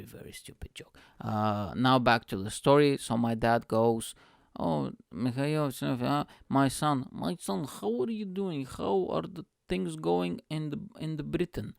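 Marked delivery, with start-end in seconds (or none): 4.6-11.7 put-on high-pitched Bulgarian-accented father; imitating dad, thick Bulgarian accent; dad's Bulgarian accent. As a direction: greet your son in a Bulgarian accent. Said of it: "I couldn't do the accent".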